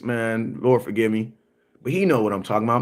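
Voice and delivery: normal talking voice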